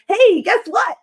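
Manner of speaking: mock-excited husband, high and loud; excited announcement. Wife retells husband's ghost claim, excited announcement.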